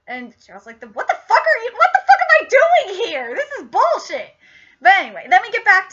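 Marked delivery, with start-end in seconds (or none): 0.8-4.3 high-pitched incredulous yelp, half laughing; hammed-up exasperated outburst climbing high; mock outrage. Reviewer acting out Gerald's outburst, mock outrage.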